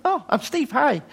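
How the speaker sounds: high, bright mock-innocent greeting; cheery self-introduction. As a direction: play it breezy and naive. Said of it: "I went".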